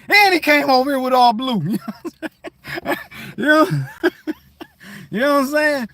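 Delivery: real laughter breaking through; laughing. Real, not acted. Laughs at own story.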